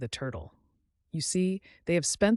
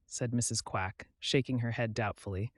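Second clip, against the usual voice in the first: usual speaking voice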